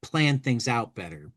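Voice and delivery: usual speaking voice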